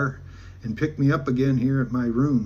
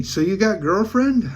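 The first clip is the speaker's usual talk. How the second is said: higher, louder, drawn-out question; curious question; 6 semitones higher, 5 dB louder than usual. Retold or imitated. Woman asking the teller about his love life, retold curious question. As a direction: imitate her asking, slowly lifting the pitch. Voicing her question to him.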